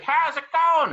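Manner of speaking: high, loud friendly call. Retold or imitated. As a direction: shout the greeting across the yard. Neighbor calling from afar.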